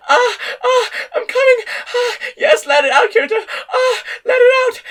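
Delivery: very high, loud, tearful cries; frantic and lost in it; delirious cries. A narrator's reading. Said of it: "deliriously sweet moans".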